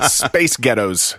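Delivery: "Space Ghettos" drawn out in an American accent. Shown as a demonstration. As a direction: answer slowly in American English. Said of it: "Space Ghettos in a more American accent".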